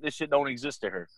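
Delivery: usual speaking voice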